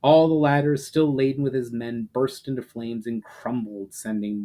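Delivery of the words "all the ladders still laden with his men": ordinary delivery, nothing marked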